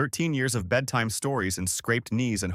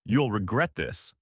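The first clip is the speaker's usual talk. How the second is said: cold threat. A narrator's reading. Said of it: "finally cold calculation".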